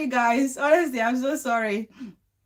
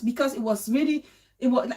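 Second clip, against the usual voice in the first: normal talking voice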